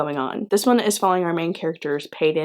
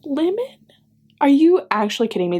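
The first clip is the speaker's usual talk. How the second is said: incredulous; 8 semitones higher than usual. Real, not acted. Disbelief at cute nickname.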